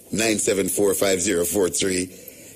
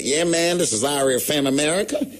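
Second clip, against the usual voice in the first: imitating an American radio host; mock American DJ; 6 semitones higher than usual. Host imitating an American radio DJ accent. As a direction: put on a mild American DJ voice. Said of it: "you man say".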